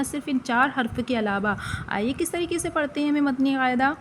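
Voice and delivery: usual speaking voice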